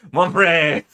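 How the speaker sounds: loud, exclaimed mock French accent. Streamer shouts at the character in mock French. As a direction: proclaim it like a hammy Frenchman. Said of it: "what French people sound like".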